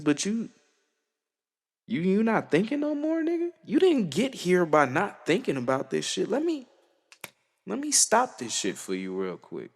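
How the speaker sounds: lightly hammed-up God calling him out; voicing God. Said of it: "And then God was like".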